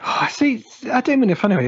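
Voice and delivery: high, loud disbelieving outburst; incredulous. Real, not acted. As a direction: react like it's absurd. Incredulous reaction.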